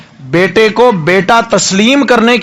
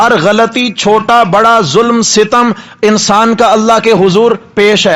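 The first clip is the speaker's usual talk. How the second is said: usual speaking voice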